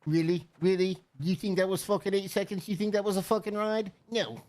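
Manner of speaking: a hint of a scornful Jersey cowgirl; Jersey rodeo girl. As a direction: sass the rider in a mild Jersey accent. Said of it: "I could hear her right now".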